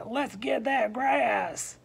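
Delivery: loud, high, drawn-out rallying cry; greedy goat cheer. Acted. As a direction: whoop it out slowly as a hungry goat. Greedy goats cheer each other on.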